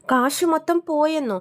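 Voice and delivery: mother shocked that all the money is gone; shocked outburst. Retold or imitated. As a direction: hit it like the news just landed. Mother hears money lost.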